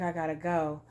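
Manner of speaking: low, soft quote of a man leaving; calm departing man. Retold or imitated. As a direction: answer softly and low, unmoved. Man's calm reply to her pleas.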